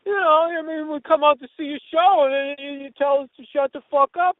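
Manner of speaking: high-pitched, put-on grievance; complaining fans. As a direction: imitate upset fans who will not listen. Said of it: "then they would just go".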